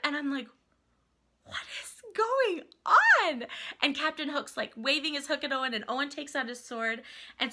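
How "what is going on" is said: high, loud, drawn-out disbelief; retold amazement. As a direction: stretch it slowly in overwhelmed astonishment. Retells her amazed reaction.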